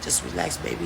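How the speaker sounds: very low, barely audible imitation of him; murmur in the ear. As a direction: sink right down into a hushed, soothing mock murmur. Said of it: "He in my ear like".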